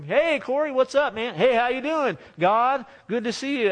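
joking impression of God as a loud buddy; mock chatty God. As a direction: ham up a God who says hi like a pal.

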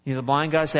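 usual speaking voice

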